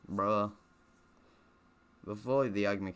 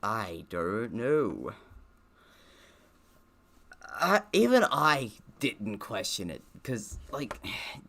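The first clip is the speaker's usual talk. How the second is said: exasperated; 2 semitones higher, 5 dB louder, slower than usual. Real, not acted. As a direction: drag it out in disbelief. Exasperated about the plot.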